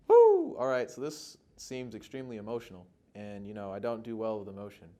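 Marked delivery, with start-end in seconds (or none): 0.1-0.6 loud, high-pitched "woo"; sudden high woo; high whoop. Real, not acted. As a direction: let out a big whoop of relief after an emotional read. Exhales after emotional read-through.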